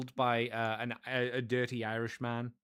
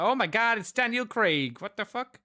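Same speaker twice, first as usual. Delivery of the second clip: higher, louder, mock-shocked outburst; mock surprise; 8 semitones higher, 5 dB louder than usual. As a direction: gasp as if spotting a star on screen. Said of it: "I was like".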